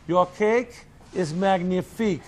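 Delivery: put-on French accent. Retold French chef phone call.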